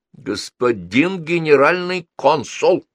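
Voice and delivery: slow, high, stern calling to order; emphatic drawn-out reprimand. A narrator's reading. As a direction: cut him off, stretching each word. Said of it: "повысила голос бабушка".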